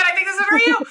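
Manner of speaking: high, loud and thrilled; excited. Retold or imitated. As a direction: announce it with giddy joy. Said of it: "because I'm like".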